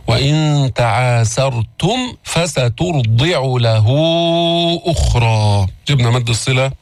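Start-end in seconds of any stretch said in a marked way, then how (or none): 0.1-5.7 Quran recitation. Shown as a demonstration. Teacher recites verse again.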